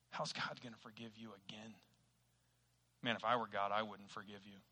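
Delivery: hushed, accusing undertone; low-key, quiet taunting; insinuating Satan. Retold or imitated. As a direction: softly plant doubt like a tempter. Voices Satan's accusing whispers to believer.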